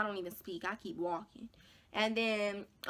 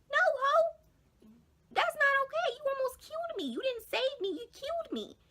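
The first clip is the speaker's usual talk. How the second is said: very high, squealed protest; hammed-up outrage in a high squeal; indignant retort; 4 dB louder than usual. Telling the friend she almost killed her, squealing indignation. Acted retort to false rescuer.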